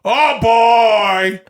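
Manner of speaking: big drawn-out actor impression; De Niro doing Al Pacino. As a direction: belt out the catchphrase as a hammy impression. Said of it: "My friend Al Pacino".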